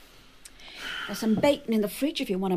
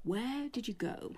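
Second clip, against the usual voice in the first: quiet insistence; 10 dB quieter than usual. Acted. Presses quietly for an answer.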